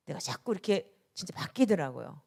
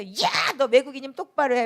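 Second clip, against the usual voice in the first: put-on imagined old angry self; 5 semitones higher, 8 dB louder than usual. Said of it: "옛날 같아".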